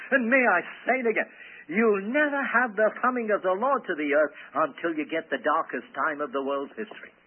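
usual speaking voice

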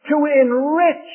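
enrich lifted high and loud, slowed; stress on enrich. Real, not acted. Preacher lifting one phrase to the husbands. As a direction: lean hard on enrich.

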